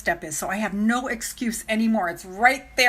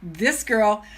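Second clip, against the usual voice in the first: ordinary talk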